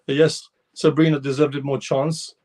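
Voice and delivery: ordinary delivery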